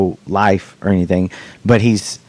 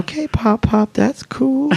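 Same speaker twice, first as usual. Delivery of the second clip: high, slow put-on kid voice; humoring granddaughters. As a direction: imitate kids indulging their grandpa. Voicing daughters humoring their grandfather.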